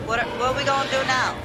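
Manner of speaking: normal talking voice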